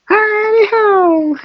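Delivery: very high, slow singsong cry; cartoon catchphrase. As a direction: sing it out like a cartoon poop.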